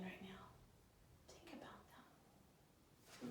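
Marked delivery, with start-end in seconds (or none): 1.3-3.3 slow breathy whisper; a slow hushed whisper to reflect. Real, not acted. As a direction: whisper it slowly, drawing them inward. Counselor prompting kids to reflect.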